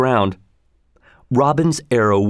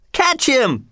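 much higher call to seize him; cried; 13 semitones higher than usual. A narrator's reading. Third forester calls to seize Robin, much higher. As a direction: yell for the others to grab him. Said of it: "cried a third".

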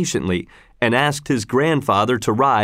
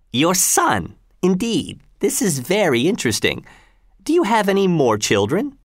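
higher-pitched, lightly voiced governor taking an interest; governor Gessler; 8 semitones higher than usual. Governor questioning Tell about his children, lightly voiced and higher. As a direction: lightly play a questioning, self-important governor.

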